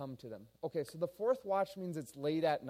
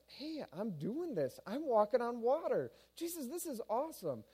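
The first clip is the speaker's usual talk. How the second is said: high, giddy voice of wonder; thrilled disbelief; 8 semitones higher than usual. Acted. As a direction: marvel at your own feat, pitched high. Peter thrilled walking on water.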